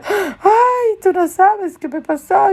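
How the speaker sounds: very high, wailing lament; distressed woman. Retold or imitated. Troubled stranger pours out her story to Patricia, high distressed lament. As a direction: imitate an overwrought woman high in pitch.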